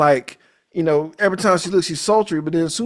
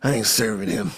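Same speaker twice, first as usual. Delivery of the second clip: knowing low mutter; 7 semitones lower, 5 dB quieter than usual. Retold or imitated. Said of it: "I was like".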